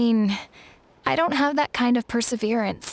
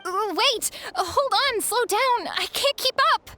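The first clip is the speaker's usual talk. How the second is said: panicked pleading; 12 semitones higher than usual. Acted. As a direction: yelp it out, left behind and scared. Dragged into the air too fast.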